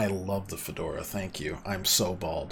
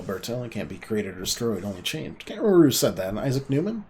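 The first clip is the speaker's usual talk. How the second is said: usual speaking voice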